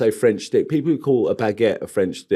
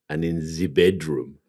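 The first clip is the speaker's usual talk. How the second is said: put-on softer, lower, sultry French accent; mock French accent; 9 semitones lower, 6 dB quieter than usual. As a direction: slip into a seductive French accent. Teasing innuendo about gardening being sexy.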